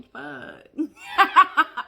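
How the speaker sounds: slowed, laughing disbelief; laughing at her own joke. Real, not acted.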